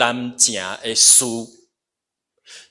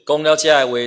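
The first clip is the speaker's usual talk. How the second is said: normal talking voice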